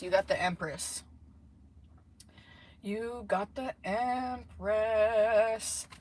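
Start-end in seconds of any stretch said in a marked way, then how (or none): 2.8-5.9 very slow, stretched-out words; drawn-out delight. Real, not acted. Tarot reader announcing a lucky card, very drawn out. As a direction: savour it, drawing EMPRESS right out.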